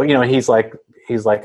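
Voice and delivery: usual speaking voice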